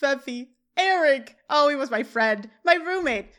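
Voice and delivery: high, loud cries of hammed-up grief; mock scream. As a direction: cry out the names in melodrama. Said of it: "Jack screams".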